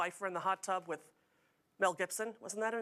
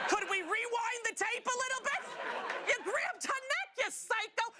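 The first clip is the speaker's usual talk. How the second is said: incredulous outburst; 12 semitones higher than usual. Real, not acted. Outraged at the reality star.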